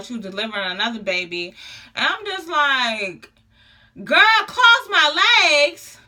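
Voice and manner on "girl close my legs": high, loud, drawn-out protest; incredulous. Retold or imitated. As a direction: stretch it out in loud disbelief. Retold reaction to nurse's order.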